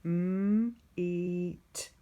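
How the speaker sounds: "meet" drawn out sound by sound. Shown as a demonstration. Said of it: "Here's the next word".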